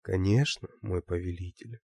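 soft seductive purr. Acted. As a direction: purr it like a femme fatale. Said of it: "промурлыкала Лия".

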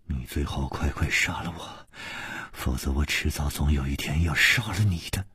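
low, hushed, severe warning; stern threat. A narrator's reading. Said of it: "厉声说".

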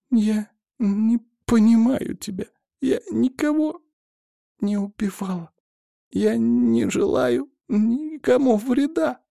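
high, slow, fading plea; weakening voice. A narrator's reading. Choking girl protests her innocence to her attacker, weakly. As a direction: eke out the words as consciousness slips away. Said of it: "Голос слабел".

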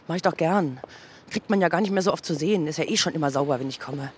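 usual speaking voice

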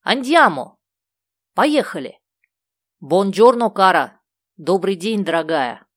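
put-on husband greeting the girl happily in the car; cheerful. A narrator's reading. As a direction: call out gleefully, all set to go. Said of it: "весело отозвался Карло".

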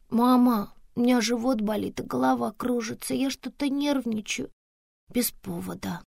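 put-on high, plaintive child voice; little girl complaining. Daughter telling mama she feels unwell, plaintively. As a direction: play a child listing aches, softening off.

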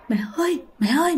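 high, softened cry for mum; mock frightened calling. Scared child clinging to mother, imitated frightened calling. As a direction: call "mum" high and small, retold.

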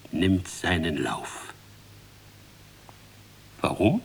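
normal talking voice

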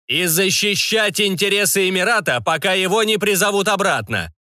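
high, booming magical proclamation; magically amplified voice. A narrator's reading. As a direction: thunder it like an enchanted herald. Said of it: "прогремел голос, усиленный магией". (голос, усиленный магией)

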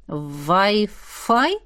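puzzled question. Acted. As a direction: ask it not understanding. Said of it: "не понял мужчина".

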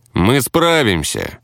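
proud. A narrator's reading. Role-player refusing a healer, proudly. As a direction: draw it out with pride. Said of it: "гордо ответил ролевик".

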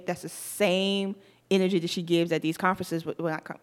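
usual speaking voice

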